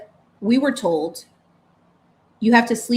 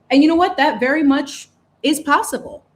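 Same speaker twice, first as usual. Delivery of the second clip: higher and louder, an affirming burst; emphatic uplift; 4 semitones higher, 6 dB louder than usual. Real, not acted. Telling parents a better life is possible, emphatic and upbeat. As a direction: lift it up like good news. Hopeful turn after parent quote.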